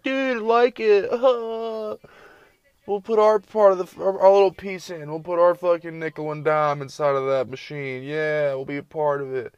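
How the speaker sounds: high, loud mock kid voice; hammed-up squeaky kid enthusiasm; excited little kid. Little kids eager to be part of it, high excited mimicry.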